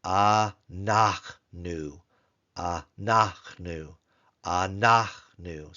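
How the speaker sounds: slow model word anachnu, ch as a throaty kh; teacher slowly models anachnu, a-NACH-nu with a rasping ch. Shown as a demonstration. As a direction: spell out anachnu slowly for learners, guttural ch. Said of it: "It is".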